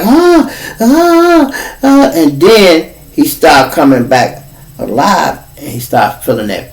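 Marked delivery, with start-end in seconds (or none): 0.0-2.1 high yelps of pain; mock panicked cries; freaking out. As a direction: yelp like a scared kid. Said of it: "He started freaking out like".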